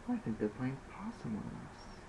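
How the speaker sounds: soft calm warning; calmly. Acted. Al calmly warns the others about the bodies, soft. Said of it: "He states calmly".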